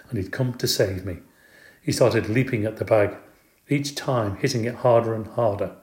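ordinary delivery

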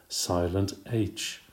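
Silent H said slow and soft; emphasized silent H. A narrator's reading. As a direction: lean slowly on Silent H. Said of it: "honest starts with a silent H".

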